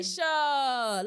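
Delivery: "Carisha" loud and drawn out; exasperated emphasis. Real, not acted. Co-host objecting to whom he addressed, "Carisha" loudly drawn out. Exasperated that he targeted the wrong person.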